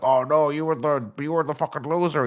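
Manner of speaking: taunting like a gloating villain; Venom taunt. Retold or imitated. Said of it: "And he's like".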